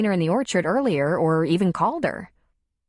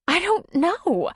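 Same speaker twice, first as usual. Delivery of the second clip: slow, high, near tears; tearful; 12 semitones higher, slower than usual. A narrator's reading. Darla near tears with Tom, slow and high. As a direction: let the tears come into it. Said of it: "Her eyes filled with tears".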